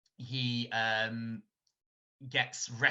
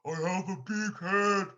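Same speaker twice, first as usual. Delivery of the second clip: muffled T-Rex voice; 8 semitones higher than usual. Retold or imitated. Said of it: "in this kind of muffled voice".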